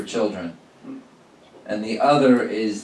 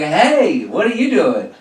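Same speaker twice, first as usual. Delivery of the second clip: high bright hey what are you doing; cheery greeting; 6 semitones higher, 3 dB louder, slower than usual. Retold or imitated. Friendly hail to a masked stranger. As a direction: greet him like an old friend.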